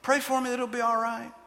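high, wheedling brush-off plea; mock pleading. Imitating a gospel rejecter asking for prayer, high and pleading. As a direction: mimic someone dodging with a sweet request. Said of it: "This is very typical of people".

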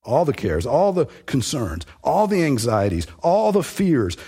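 each "all" hit at the start of every phrase; stress on all. Shown as a demonstration. Preacher driving home the point to listeners, stressing each "all". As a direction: build the list, punching "all" and rising to "all the fears". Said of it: "the all part is emphasized".

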